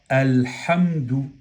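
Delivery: "alhamdu" slow, a clear L before the h; lam pronounced. Shown as a demonstration. Teacher demonstrating the article before ha, slowly pronounced lam. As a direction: stretch "alhamdu" so the lam is heard. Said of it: "en este caso pronunciamos la lam de al".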